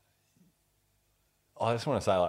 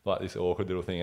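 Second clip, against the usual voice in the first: usual speaking voice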